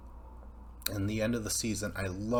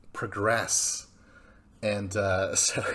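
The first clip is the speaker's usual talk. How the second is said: usual speaking voice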